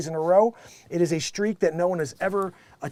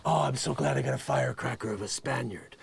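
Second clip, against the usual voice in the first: soft mock Alec; 8 semitones lower, 6 dB quieter than usual. Mocking Alec in interviews.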